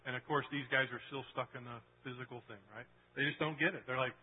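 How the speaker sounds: ordinary talk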